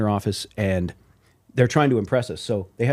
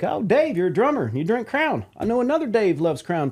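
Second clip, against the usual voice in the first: higher, playful put-on voice; label president teasing; 8 semitones higher than usual. Imitating the president teasing the drummer, higher and playful. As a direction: rib the drummer knowingly. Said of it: "he's like".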